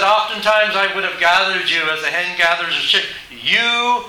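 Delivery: high, pressing pulpit voice; forceful preaching. Acted. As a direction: proclaim it, landing hard on the last words. Quotes Jesus forcefully from pulpit.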